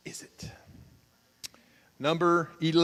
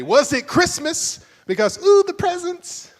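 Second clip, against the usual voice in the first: excited about presents; 12 semitones higher, 7 dB louder than usual. Pretend. Pastor describing a Christmas-like Valentine's, excited gushing. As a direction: gush over the presents.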